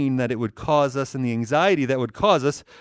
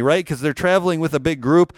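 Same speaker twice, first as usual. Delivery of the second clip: normal talking voice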